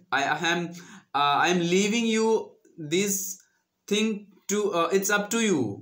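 ordinary talk, nothing marked

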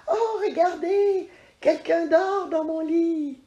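the cub thrilled at his discovery; squealing excited cub voice; excited little bear. Acted. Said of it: "petit ours crie, tout excité".

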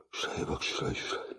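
breathy hushed whisper, no voice at all; awed whisper. Real, not acted. To viewers while digging up gold, an awed whisper. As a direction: lean in and whisper it like a secret.

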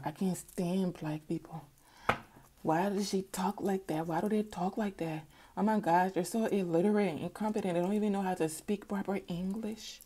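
mocking a complainer under her breath; judgemental woman impression. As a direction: quietly grumble like a snooty critic. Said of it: "this is a woman to be watching people".

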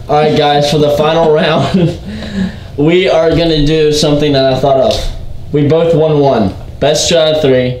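loud hyped host announcement; announcer voice. Acted. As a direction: address the camera loudly. Announcing final round to viewers.

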